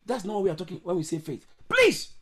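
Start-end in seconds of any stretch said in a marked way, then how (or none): 1.7-2.1 very high, loud protesting "please"; sudden high yelp of protest; exasperated cry. Real, not acted. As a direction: burst out in a high-pitched plea. Repeats the protest.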